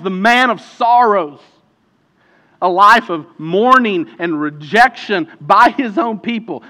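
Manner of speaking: loud, rising pulpit preaching; preaching at full force. Acted. Preacher urging the church to see Jesus, loud and fervent. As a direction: build it up and proclaim to the congregation.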